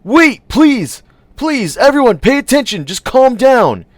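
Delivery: high, loud plea, slowing at the end; pleading shout. A narrator's reading. Munch pleading with the panicking crowd, high and loud. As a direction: beg the crowd to settle down.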